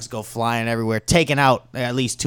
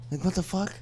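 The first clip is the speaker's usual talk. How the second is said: high-pitched outcry, building louder; alarmed bystanders; 11 semitones higher, 6 dB louder than usual. Acted. Bystanders finding all managers gone, alarmed and high. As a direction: exclaim in mounting high-pitched dismay.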